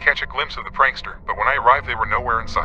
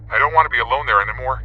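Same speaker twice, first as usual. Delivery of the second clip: ordinary delivery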